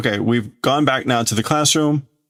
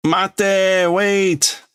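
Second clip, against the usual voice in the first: calling out; 10 semitones higher than usual. Acted. Kid calls after Shibugaki to wait, high shout. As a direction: call after him to stop.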